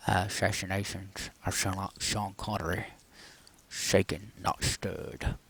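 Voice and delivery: hushed, slurred, slushy movie-spy impression; bad Sean Connery. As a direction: drop the volume and slur the s into sh. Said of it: "that's a bad impression".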